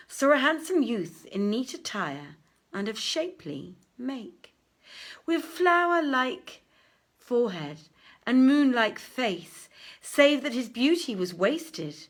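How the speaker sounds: grand read-aloud storytelling; story narration. Acted. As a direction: tell the tale out to the room like a bard. Reads the tale aloud.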